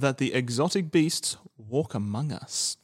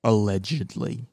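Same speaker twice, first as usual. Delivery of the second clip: sceptical allegedly; 3 semitones lower than usual. Shown as a demonstration. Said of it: "Preface with alleged".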